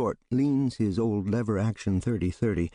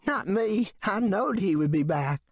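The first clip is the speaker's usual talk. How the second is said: high, knowing voice; sure backwoods man; 12 semitones higher than usual. Acted. Man answers Bogus, knowing backwoods voice. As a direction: insist you saw it coming.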